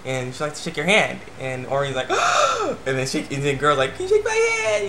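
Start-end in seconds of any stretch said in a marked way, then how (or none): 2.1-2.8 high, exaggerated gasp of surprise; mock gasp. Reviewer imitates a girl asked for a handshake, big mock gasp. Oreen reacts to the girl's request. 3.9-4.9 put-on very high little-girl voice; imitated little girl. Reviewer as a little girl asking her idol, high child voice. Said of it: "this little girl comes".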